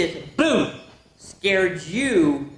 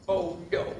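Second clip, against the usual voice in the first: ordinary delivery, nothing marked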